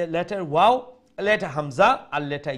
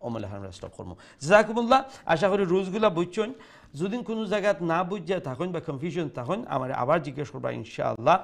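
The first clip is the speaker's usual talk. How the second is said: usual speaking voice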